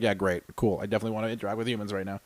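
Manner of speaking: low, soft deadpan; dry sarcasm. Retold or imitated. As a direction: feign agreement in a low, spent voice. Sarcastic retold reply, drained.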